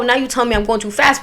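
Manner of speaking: plain reading voice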